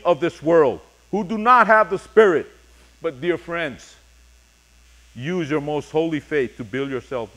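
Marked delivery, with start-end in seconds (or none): none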